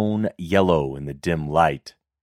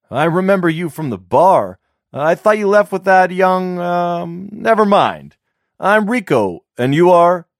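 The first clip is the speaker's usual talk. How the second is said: much higher, louder chatty voice; eager flirting man; 11 semitones higher, 6 dB louder than usual.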